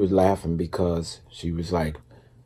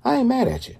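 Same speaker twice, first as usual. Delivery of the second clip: very high, squeaky-pitched teasing line; playful self-quote; faster than usual. Retold or imitated. Teasing a hard-working friend in a very high playful voice. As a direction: pitch way up and tease her affectionately. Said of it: "And I was like".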